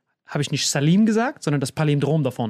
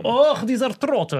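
high, slow groan of scorn; mock exasperated groan; 7 semitones higher, 3 dB louder, slower than usual. Friend who fled judging the caught boy, mock exasperation. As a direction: drag it out in exasperation.

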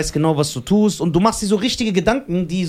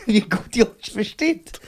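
laughing; 6 semitones higher, slower than usual. Real, not acted. Laughs while praising.

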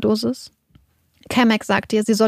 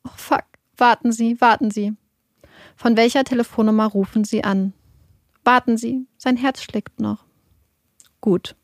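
usual speaking voice

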